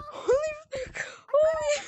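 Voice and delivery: high, crying laughter; laughing shriek. Real, not acted. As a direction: squeal through the laugh. Still laughing hysterically.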